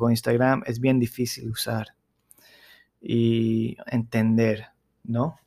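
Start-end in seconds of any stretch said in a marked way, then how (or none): none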